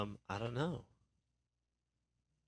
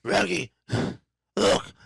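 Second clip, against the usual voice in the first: high, loud cartoon dog voice; Scooby-Doo; 8 semitones higher, 7 dB louder, slower than usual. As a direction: bark the words like a talking dog. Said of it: "Oh, Scooby-Doo".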